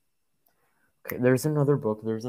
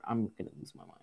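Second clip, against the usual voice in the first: normal talking voice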